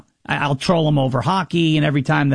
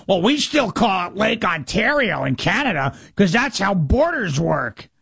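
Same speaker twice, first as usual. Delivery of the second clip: mocking a smug Canadian; 2 semitones higher than usual. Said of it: "I had one of these guys going".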